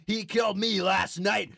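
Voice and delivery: ordinary talk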